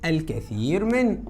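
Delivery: class answer said slowly; slow choral-style answer; slow, drawn out. Shown as a demonstration. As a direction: let the class fill it in slowly. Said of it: "معناها ايه يا ولاد؟".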